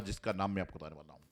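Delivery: ordinary delivery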